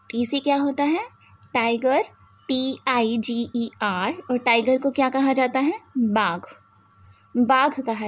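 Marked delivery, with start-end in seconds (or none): 2.5-4.1 spelling aloud. Shown as a demonstration. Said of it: "spelling tiger letter by letter".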